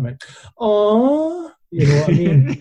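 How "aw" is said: high, drawn-out "aww"; mock sentimental high-pitched aww; imitated lasses' aww. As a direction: coo like a moved audience. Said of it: "a couple of lasses at the front".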